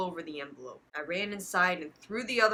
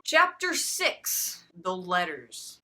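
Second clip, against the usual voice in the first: chapter title announcement; 3 dB louder, much slower than usual. Acted.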